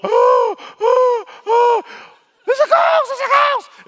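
gasping, very high and loud, terrified; panicked disciples. Acted. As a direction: shriek it as if a ghost is coming at you. Disciples see a figure on water.

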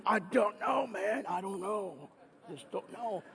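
a stumped doctor trailing off; puzzled doctor. Retold or imitated. Doctor with no answer, trailing off quietly.

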